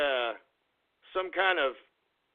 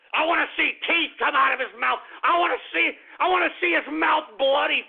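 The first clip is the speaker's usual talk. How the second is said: angry outburst; 8 semitones higher than usual. Acted. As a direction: blow up on the repeat. Repeats the threat, louder.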